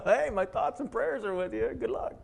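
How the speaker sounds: high, airy fake concern; mock sympathy. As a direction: chirp it up high as an empty well-wisher. Mocking bystander to drowning man.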